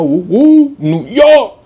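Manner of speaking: high, loud hype cheer for New York; mock New York street holler. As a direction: belt it out like a street hype man. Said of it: "I was trying to do that, this guy's accent".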